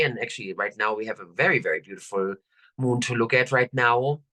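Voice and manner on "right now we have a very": usual speaking voice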